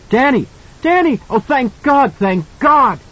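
high, loud mother's cry; mother finding her son alive; relieved calling out. A narrator's reading. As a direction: call out in tearful relief.

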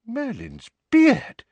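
stunned gasp of words; surprised. A narrator's reading. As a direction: Mr Weasley stunned by the scene. Mr Weasley voiced.

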